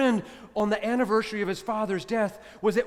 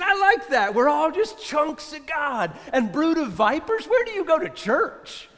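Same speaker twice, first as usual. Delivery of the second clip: much higher and louder, delighted ribbing; amused teasing; 8 semitones higher, 5 dB louder than usual. Retold or imitated. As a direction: tease him with a delighted grin. Amused colleague teasing the friend.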